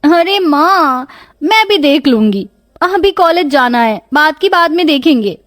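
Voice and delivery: dismissive daughter. Acted. Daughter hurrying to college brushes off mother's warning, dismissive.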